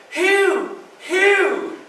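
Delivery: high-pitched calling of a name; a woman calling her husband; pleading wife's call. Retold or imitated. Miss Sophia calling husband.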